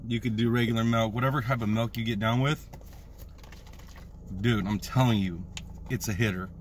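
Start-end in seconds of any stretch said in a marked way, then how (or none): none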